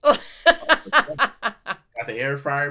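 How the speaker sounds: slow drawn-out words through laughter; laughing as the air fryer gets named. Real, not acted. Friend telling a pizza story, laughing slow.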